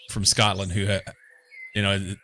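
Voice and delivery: usual speaking voice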